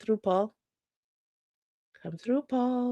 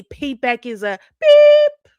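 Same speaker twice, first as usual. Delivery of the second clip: loud slow punchline; 6 semitones higher, 12 dB louder, slower than usual. Acted. Censored punchline to viewers.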